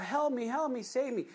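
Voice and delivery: high-pitched pretend plea of a drowning man; imitating a drowning person's cries; mock cry for help. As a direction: call out as if drowning, lightly acted. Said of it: "They're crying out".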